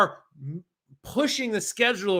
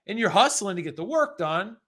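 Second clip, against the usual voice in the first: usual speaking voice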